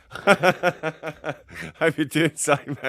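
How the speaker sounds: drawn-out greeting through a laugh. Real, not acted. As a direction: laugh your way through the hello. Greeting a friend while laughing.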